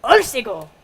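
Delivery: loud, falling Korean cheer; called-out cheer. Shown as a demonstration. Performer teaching the audience a cheer, called out loud. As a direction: yell it out with spirit. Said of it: "you just yell out".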